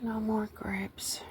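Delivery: soft slow whisper. Real, not acted. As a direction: breathe it out, disappointed. Disappointed about the missing grapes.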